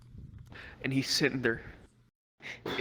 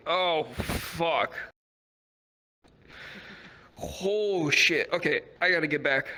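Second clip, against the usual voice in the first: edibles-hit panic; 3 semitones higher, slower than usual. Retold or imitated. Edibles hitting suddenly.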